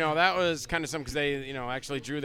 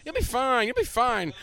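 higher-pitched, repeated reassurance; retold coaxing; 8 semitones higher, slower than usual. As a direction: imitate a promoter brushing off nerves. Promoter coaxing the nervous actor.

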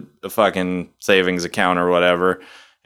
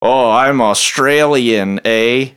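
slow, higher mock Australian accent, a bit louder; 4 semitones higher, 4 dB louder, much slower than usual. Friend asks for his Australian accent, hammed-up Australian. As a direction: ham up an Aussie drawl on request. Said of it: "Do the Australian accent".

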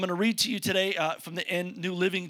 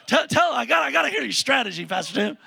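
higher, louder, begging like an impatient fan; mock eager listener; 6 semitones higher, 5 dB louder, faster than usual. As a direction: play the eager congregant who can't wait.

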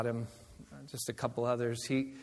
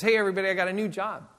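quick, higher, bright news to the group; excited announcement; 6 semitones higher, 5 dB louder, faster than usual. Retold or imitated. Friend telling the church group his new job, excited and quick. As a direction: burst in with big news.

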